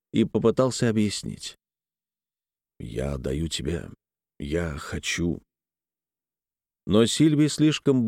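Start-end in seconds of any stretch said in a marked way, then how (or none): 2.8-5.4 lower, softer husband voice; hesitant, withdrawn man; husband's voice. A narrator's reading. Weber struggles to explain.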